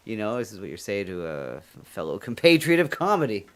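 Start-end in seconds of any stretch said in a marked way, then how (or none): none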